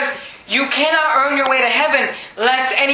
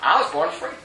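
low, softer and understated; simply stated; 7 semitones lower, 5 dB quieter than usual. Acted. Paul answering the boasting centurion, simply and quietly. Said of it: "But Paul just says".

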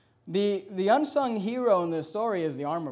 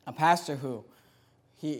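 usual speaking voice

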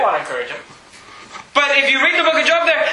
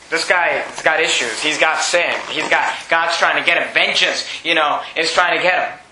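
low-pitched, lightly acted disapproval; judging onlookers; 7 semitones lower than usual. Preacher voicing people watching the attack, low judging tone. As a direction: pass judgment in a low bystander's voice.

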